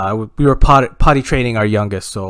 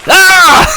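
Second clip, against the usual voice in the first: high, loud cry of exasperation; frustrated scream; 9 dB louder than usual. Acted. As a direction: scream in defeat. Dad frustrated by Alexa's answer.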